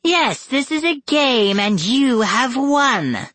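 very high, singsong proclamation; high-pitched, drawn-out delight; gleeful villainess. A narrator's reading. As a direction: announce it grandly as a wicked mother. Villain mother proclaims to captives.

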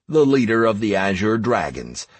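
ordinary delivery

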